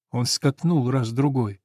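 ordinary delivery

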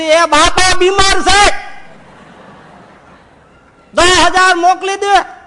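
the loudest yell down the phone. Acted. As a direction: bellow it word by word. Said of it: "જોરથી બોલ".

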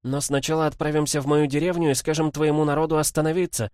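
put-on high girl's voice laying out a plan; Цзиньвэй. A narrator's reading. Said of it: "а голос Цзиньвэй добавил". (высокий голос девочки)

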